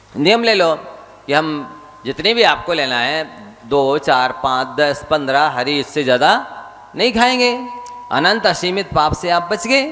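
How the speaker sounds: high, insistent sermon pleading; a monk exhorting his listeners; fervent preaching. Acted. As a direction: press them to take the vow. Urging vow on vegetables.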